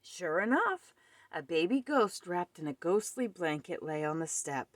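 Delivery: plain reading voice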